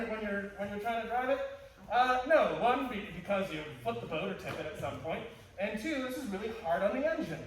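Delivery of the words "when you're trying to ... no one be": usual speaking voice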